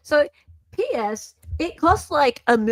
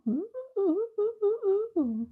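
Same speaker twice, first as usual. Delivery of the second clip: high admiring coos; 10 semitones higher, 8 dB quieter than usual. Real, not acted. Admiring a signature.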